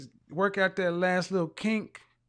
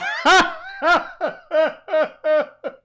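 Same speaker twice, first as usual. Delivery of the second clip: high, loud burst of laughter; 8 semitones higher, 7 dB louder than usual. Real, not acted. Host cracking up at caller's comment, loud laugh.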